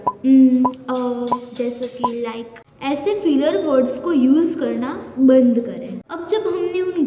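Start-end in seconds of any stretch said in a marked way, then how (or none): 0.1-2.7 drawn-out ums, slowing as it goes; filler-word hesitation. Shown as a demonstration. Teacher showing viewers which filler words to drop, hesitant and drawn-out. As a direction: demonstrate hesitant stalling between fillers. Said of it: "filler words का use करना".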